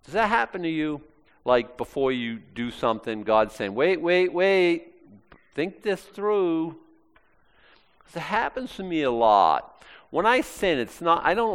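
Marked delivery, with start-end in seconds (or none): 3.7-6.8 cautioning. Acted. Voices God warning before sin.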